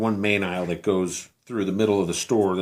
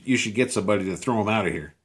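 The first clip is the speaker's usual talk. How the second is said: normal talking voice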